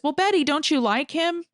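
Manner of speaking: lightly voiced sly man nudging her; coaxing [name].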